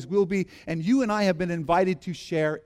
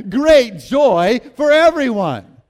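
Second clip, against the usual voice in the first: laughing, slow, louder joyful cry; joyous; 6 semitones higher, 13 dB louder, slower than usual. Shown as a demonstration. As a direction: shout it out full of laughing joy. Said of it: "Now say it in a joyous way".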